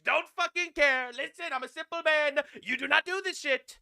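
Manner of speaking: angry shouting. Real, not acted. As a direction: yell it like an outraged hawk. Ranting about Iran attack plot.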